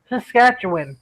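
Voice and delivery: ordinary talk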